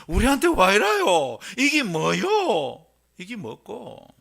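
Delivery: loud, high Gyeongsang dialect outcry. Acted. Preacher voicing startled shepherds, Gyeongsang dialect. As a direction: cry out in alarm with a Gyeongsang accent. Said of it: "경상도 사투리로 번역한다면".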